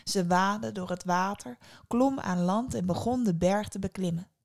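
ordinary delivery

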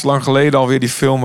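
normal talking voice

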